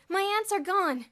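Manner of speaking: sudden loud high cry of alarm; cried out. A narrator's reading. Elmer finding his ants gone, crying out loud. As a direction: blurt it out in shock. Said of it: "he cried suddenly".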